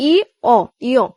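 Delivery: "ই", pause, "অ", then joined as "ইয়"; sounds then blend. Shown as a demonstration. Teacher sounding out a vowel, "ই" and "অ" blended into "ইয়". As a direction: split "ই" and "অ", then run them together as "ইয়". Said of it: "এটার উচ্চারণটা কি হয়েছে".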